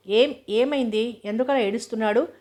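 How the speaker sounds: ordinary delivery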